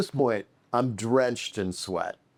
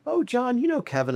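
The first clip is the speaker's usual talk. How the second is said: [name]. Retold or imitated. Imitating the director's introduction.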